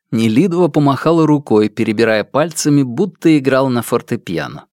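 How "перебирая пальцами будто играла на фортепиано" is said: ordinary delivery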